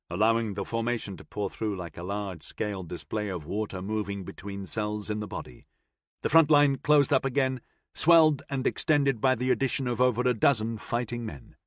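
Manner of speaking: usual speaking voice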